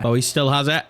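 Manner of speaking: usual speaking voice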